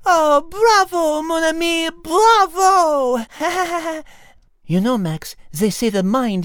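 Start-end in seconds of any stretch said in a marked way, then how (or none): 0.1-3.2 louder, mock applause; mock bravo. Cat mockingly cheering the host's guess. 3.3-4.0 light amused laugh. Acted. Cat laughing at the flustered host.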